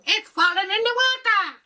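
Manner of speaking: very high, loud excited cry; a villager shouting back that the forest sank, very high panicked cry; shrill panicked answer. Retold or imitated.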